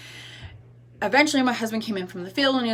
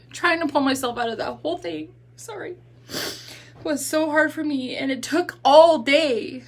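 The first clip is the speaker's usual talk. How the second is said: real crying, voice pitched up; choked up, higher and strained by crying; tearful; 6 semitones higher than usual. Real, not acted. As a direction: push on through tears. Crying, apologizes, gets tissue.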